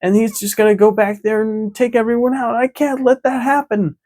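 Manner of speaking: squeaky hero working up his courage; mock-heroic Usopp. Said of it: "is like".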